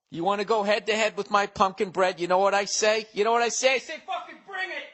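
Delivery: high taunting challenge building to a screech; hammed-up dare, climbing into a strained yell; mock challenge. Mock challenge, then admits joking.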